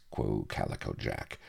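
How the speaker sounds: usual speaking voice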